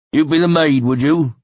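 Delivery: a thug teasing in broad West Country; West Country accent. Acted. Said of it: "in a heavy West Country accent".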